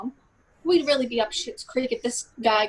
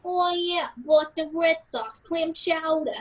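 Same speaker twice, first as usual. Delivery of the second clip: drawn-out Boston accent impression; JFK; 4 semitones higher, slower than usual. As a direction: put on a broad Boston politician's accent. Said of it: "I call this one J F K".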